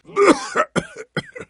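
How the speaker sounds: harsh, high coughing fit; hacking cough. Acted. Sickly hero breaking into a hacking cough. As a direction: cough hard and rough.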